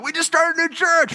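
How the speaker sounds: high-pitched, hammed-up pitch; put-on eager announcement; over-eager invitation. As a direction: chirp it like a keen recruiter. Acting over-eager church invitation.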